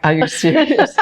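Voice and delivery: high, incredulous imitation of a client; mock disbelief. Client startled by a dinner invitation, mock disbelief. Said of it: "some of the men go".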